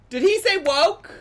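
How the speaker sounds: very high, loud disbelief; loud incredulous repeat. Real, not acted. Repeating the question about the clip, incredulous. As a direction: escalate the question into an astonished cry.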